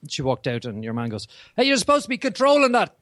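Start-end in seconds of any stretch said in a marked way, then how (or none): 1.6-3.0 loud, high-pitched Boston passenger; Boston accent. Retold or imitated. Passenger complains to the tram driver, loud Boston accent. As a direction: imitate an irate Bostonian shouting at the driver. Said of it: "I love the accent".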